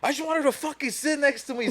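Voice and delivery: high-pitched whiny mock complaint; whiny outburst. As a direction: imitate a drunk friend going off, high and whiny. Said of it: "Starts going fucking off".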